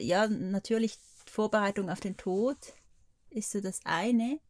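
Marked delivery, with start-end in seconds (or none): none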